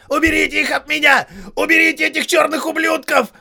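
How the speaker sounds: надрываясь, высоко и громко; орёт до хрипоты на палачей; надрывный крик. A narrator's reading. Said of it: "надрывался сержант". (надрывный крик)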